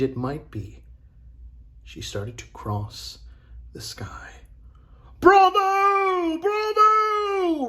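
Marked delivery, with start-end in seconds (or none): 5.2-7.7 very high, loud, long-held bravos; roaring cheer. A narrator's reading. Crowd hails the wire walkers, roaring. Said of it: "roared the crowd".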